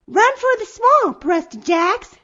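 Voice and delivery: put-on high, loud girl's threat; bold shouted warning. A narrator's reading. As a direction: call it out high to scare off a bully. Girl threatens the bully in story.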